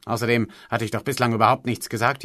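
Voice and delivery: ordinary delivery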